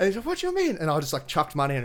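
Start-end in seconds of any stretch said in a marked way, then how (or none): 0.2-0.8 very high, louder puzzled protest; mock indignant squeak; imitated protesting masseur. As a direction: mimic the masseur calling after him, pitched way up.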